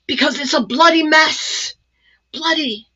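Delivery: mock English accent. Jokingly mimicking Lisa Horton's English accent for viewers. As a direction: toss off bloody in a hint of English accent. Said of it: "I should talk with an English accent".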